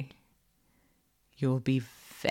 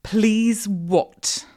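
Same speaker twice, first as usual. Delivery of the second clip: loud, raised, pointed question; demanding prompt; 4 semitones higher, 6 dB louder than usual. A narrator's reading. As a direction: demand the missing word, louder and higher. Mistress demands a proper address.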